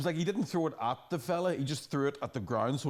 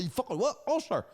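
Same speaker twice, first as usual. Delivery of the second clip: mock squaring up; 10 semitones higher than usual. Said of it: "them headbutts".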